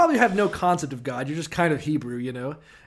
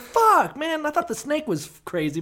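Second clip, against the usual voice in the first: mock shocked Moses; 11 semitones higher than usual. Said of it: "I was like".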